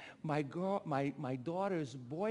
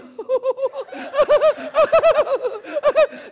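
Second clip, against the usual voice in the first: loud, very high giddy cackle; giddy squealing laugh; 19 dB louder than usual. Retold or imitated. Father imitating his newly engaged daughter, giddy laugh. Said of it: "she's all giddy".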